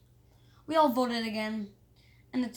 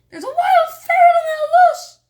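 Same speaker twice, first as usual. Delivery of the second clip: much higher, very loud screaming panic; panicked shout; 16 dB louder than usual. Acted. Kid fleeing a loose ferret, loud high terrified scream. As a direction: scream for help in terror. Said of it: "somebody shouted".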